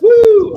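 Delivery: sudden loud, high "woo"; high whoop. Pretend. Cheering friends from the North with a high whoop. Playful whoop in the Canadian bit.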